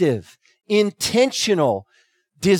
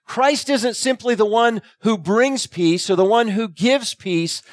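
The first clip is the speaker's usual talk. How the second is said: usual speaking voice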